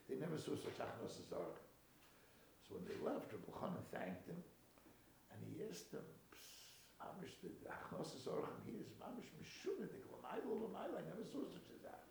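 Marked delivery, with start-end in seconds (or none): 6.3-12.0 hushed, marvelling question behind the hand; confidential aside. Acted. A guest quietly asks his host about the hospitality, confidential. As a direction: lean in softly, as if letting someone in on a puzzle.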